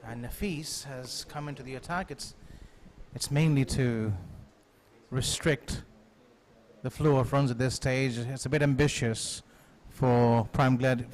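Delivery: ordinary talk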